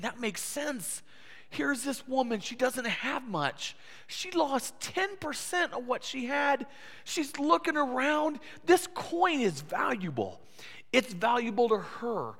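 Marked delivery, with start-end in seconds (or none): 0.0-10.4 higher and slow, reasoning it through; the crowd talking itself into sympathy; understanding crowd. Retold or imitated. Crowd reasoning about the woman, higher and slow. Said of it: "They're saying".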